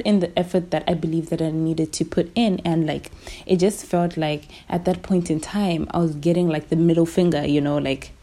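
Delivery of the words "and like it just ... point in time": whispered aside. Real, not acted. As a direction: drop to a whisper. Low point of her story.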